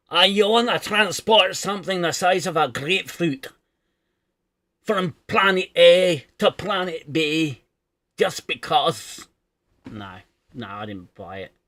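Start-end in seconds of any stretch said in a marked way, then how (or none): 0.1-7.6 loud, high-pitched mock Scottish rant; hammed-up Scottish engineer objecting at full volume; bad Scottish accent. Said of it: "So is my Scottish accent". 8.2-9.3 drawn-out mock Scottish, raised and loud; lightly hammed-up Scottish protest; bad Scottish accent. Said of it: "'cause of my bullshit Scottish accent".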